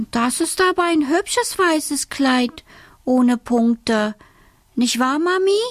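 put-on lightly sugary child's voice; little girl Alice. A narrator's reading. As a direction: coax her mother charmingly.

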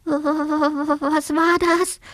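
high, drawn-out stammer on the first word; frightened cry. A narrator's reading. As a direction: tremble out a startled question, very slowly. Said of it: "rief der kleine Siebenschläfer".

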